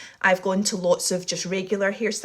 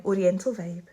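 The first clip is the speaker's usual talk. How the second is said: ordinary talk, nothing marked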